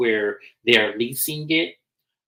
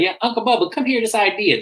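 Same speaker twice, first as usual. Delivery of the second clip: high-pitched mock call; calling someone over; 10 semitones higher than usual. Family calling the uncle into the argument, high beckoning voice. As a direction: call out high, pulling another person in.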